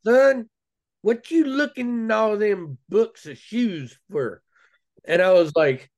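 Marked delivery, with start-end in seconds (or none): 0.1-4.4 higher, slow West Virginia grandma drawl; a grandma from West Virginia teasing her grandson, slow and high; West Virginia accent. Retold or imitated. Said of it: "I'm gonna try to do the accent".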